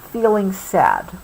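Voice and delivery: plain reading voice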